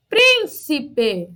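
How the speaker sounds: "príncipe" in syllables, PRÍN strongest, then falling; stress on PRÍN. Shown as a demonstration. Teacher testing pupils on the strong syllable, "príncipe" stressed on PRÍN. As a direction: hit the first syllable of "príncipe" hardest. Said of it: "qual a sílaba eu falei mais forte?".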